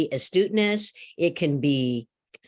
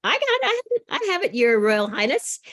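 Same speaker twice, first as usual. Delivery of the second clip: much higher, eager courtier voice; eager advisor; 9 semitones higher than usual. Acted. First advisor pitching his idea to the king, eager and high. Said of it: "his first advisor came to him and said".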